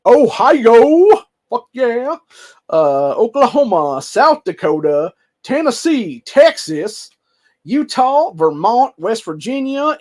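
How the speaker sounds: put-on playful Southern accent roll call. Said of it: "I went full Southern there".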